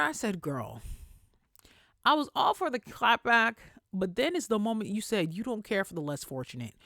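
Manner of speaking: ordinary talk, nothing marked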